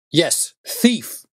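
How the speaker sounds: a father roaring at his son; roared accusation. A narrator's reading. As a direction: roar it into his face. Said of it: "I roared".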